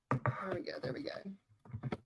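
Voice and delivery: breathy whisper to herself. Real, not acted. Crafter whispering to herself while flipping the wet paper, hushed. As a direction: murmur it under your breath, almost soundless.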